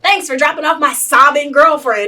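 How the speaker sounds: loud, high, sarcastic, laughing through it; mock him with the boyfriend's thanks. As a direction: daughter mocking the father with the boyfriend's imagined reply. Mocking the boyfriend's imagined thanks.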